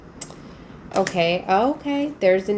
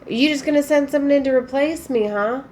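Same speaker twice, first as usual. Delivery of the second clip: higher, faster mock protest; a sarcastic jab, pitched up and hurried; mock indignant quote; 7 semitones higher, 4 dB louder than usual. Reader voicing the partner sneering at a replacement, mock indignant.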